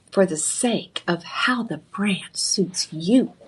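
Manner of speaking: slow whisper, a secret between friends; stage whisper. Acted. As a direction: whisper it slowly, leaning in. Confiding aside to viewers.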